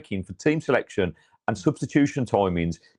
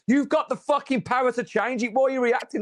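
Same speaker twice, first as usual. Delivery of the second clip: high, pitched-up yell at the big screen; shouting up at the stadium screen; retold scream at screen; 11 semitones higher than usual. Fan at the stadium yelling at the screen, a high pitched-up shout. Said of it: "I screamed at the massive eighty-yard screen".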